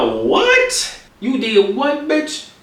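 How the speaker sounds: high, stunned squeak of disbelief; shocked disbelief. Retold or imitated. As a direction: act out total shock. Shocked reaction retold.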